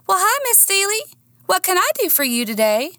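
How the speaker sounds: high, sweet fake-friendly voice; sugary receptionist. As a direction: greet with syrupy politeness. Lenora voiced in story.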